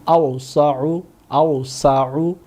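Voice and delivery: drawn-out careful repeat of আওসাউ; slow repeat. Shown as a demonstration. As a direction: say আওসাউ slowly, stretching each syllable. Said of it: "আওসাউ (slow repeat)".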